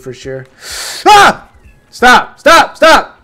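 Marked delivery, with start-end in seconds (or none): 1.1-1.5 short, high, loud yelp; startled scream. Real, not acted. 1.9-3.2 high, loud repeated yells; alarmed shouting. Real, not acted. Under attack in game.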